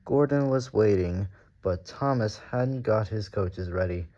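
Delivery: plain reading voice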